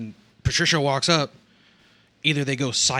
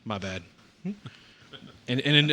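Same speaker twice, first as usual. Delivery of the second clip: normal talking voice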